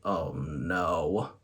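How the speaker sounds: deep, soft "Oh, no" groan; catchphrase groan, low and soft; low dismayed groan. Shown as a demonstration. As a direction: drop low and quiet in dismay. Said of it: "make you wanna say".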